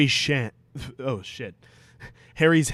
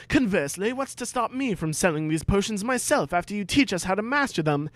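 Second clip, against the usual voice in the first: higher, keen questioning voice; eager schoolboy Harry; 8 semitones higher than usual. Acted. Harry questioning Snape in class, higher eager boy. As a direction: pipe up like a cheeky pupil.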